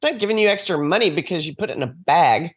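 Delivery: usual speaking voice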